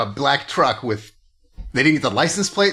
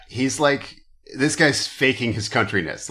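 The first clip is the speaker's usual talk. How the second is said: ordinary talk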